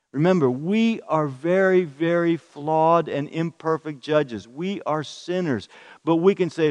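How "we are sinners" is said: ordinary talk, nothing marked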